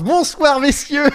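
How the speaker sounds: hyped caster greeting; esports caster. Retold or imitated. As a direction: open the show like a pumped caster. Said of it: "comme si je castais la finale".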